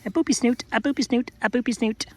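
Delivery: high playful boopy snoot chant. Real, not acted. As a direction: croon it while booping the nose. Playful baby talk chant.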